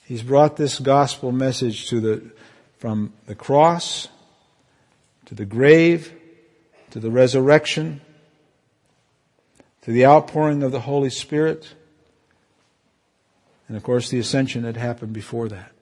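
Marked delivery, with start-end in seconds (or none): 0.0-11.7 preaching. Acted. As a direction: proclaim each step of the gospel. Preaching the gospel, slow and emphatic.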